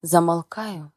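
usual speaking voice

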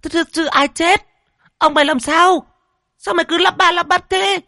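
very high, loud furious shriek; shrieking mistress. A narrator's reading. Mistress shrieks at the stammering servant, furious. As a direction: hysterical wife berating servant.

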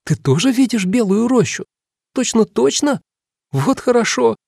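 high, squeaky and amazed; a little girl asking in wonder; surprised girlish voice. A narrator's reading. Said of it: "Дора удивлённо переспросила".

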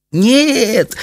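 high voice, mock breathless with mirth; barely able to speak for laughing. A narrator's reading. Daughter answering through her giggles, laughing. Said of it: "от смеха едва могла говорить".

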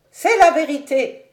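loud, high-pitched interruption; exclaiming. A narrator's reading. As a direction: cut in forcefully as the domineering mother. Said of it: "s'écria-t-elle". (exclamation forte et aiguë)

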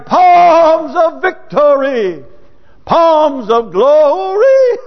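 high, loud, slow exultant shout. Retold or imitated. Weeping believers shouting for joy in the pews, loud and slow. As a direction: cry it out like a jubilant congregation. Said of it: "they started shouting".

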